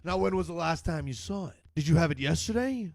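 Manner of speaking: ordinary delivery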